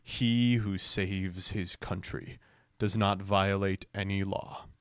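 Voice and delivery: low, slow, solemn read-out; ponderous mock-grand delivery; mock-solemn quote. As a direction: read it slowly like a weighty proclamation. Reading out a grandiose post.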